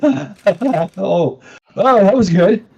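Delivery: high acted laugh winding down into words; laughter petering out. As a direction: let a laughing fit run out, still amused. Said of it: "he laughs for about six seconds".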